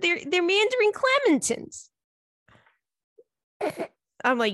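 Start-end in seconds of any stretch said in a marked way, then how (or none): 0.0-1.9 much higher mock mom voice; imitating mom. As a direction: pitch it up like a confused mother. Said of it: "She said".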